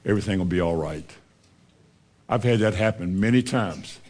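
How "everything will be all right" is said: little inner voice. Retold or imitated. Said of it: "a little voice that says".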